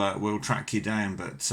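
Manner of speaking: ordinary talk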